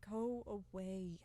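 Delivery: slow, whispered plea; frightened whisper. Acted. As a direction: beg under his breath in fear. Frightened old servant pleading.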